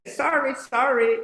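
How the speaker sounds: high, loud quick apology; apologetic hen. Acted. Miss Hen apologizes to the cat, high and loud. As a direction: pipe up with a repeated apology. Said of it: "So she says".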